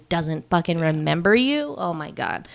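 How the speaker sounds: ordinary talk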